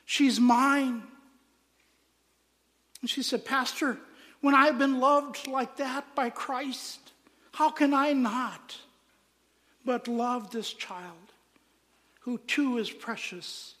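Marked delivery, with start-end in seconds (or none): 0.0-1.1 loud, higher-pitched declaration; Christ's emphatic claim. Retold or imitated. Christ claims the rejected woman, in a loud proclamation. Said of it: "It was Christ who said". 3.4-13.8 slow, raised-pitch heartfelt appeal; impassioned plea. Retold or imitated. Mother explains her love to pastor, slow and impassioned.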